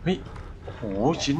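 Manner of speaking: ordinary delivery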